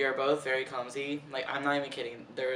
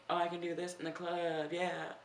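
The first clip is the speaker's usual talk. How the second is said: mock club dancer; 4 semitones higher, 4 dB quieter than usual. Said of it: "I'm not like".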